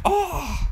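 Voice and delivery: high whiny disappointed oh; mock groan of dismay. Drunk friend upset at refusal.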